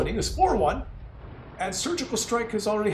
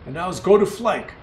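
usual speaking voice

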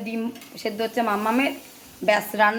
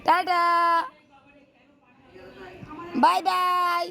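sing-song goodbye; 2 semitones higher, 7 dB quieter, much slower than usual. Real, not acted. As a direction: coax a little one to say bye. Coaxing toddler to wave goodbye.

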